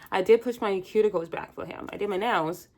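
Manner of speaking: ordinary talk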